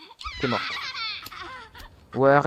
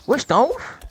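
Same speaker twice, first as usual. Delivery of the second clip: much higher, loud disbelief; shocked; 13 semitones higher, 4 dB louder than usual. Real, not acted. Gamer shocked by a creature, much higher and loud. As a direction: react in stunned disbelief, high.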